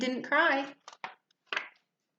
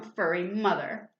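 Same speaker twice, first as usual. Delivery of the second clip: usual speaking voice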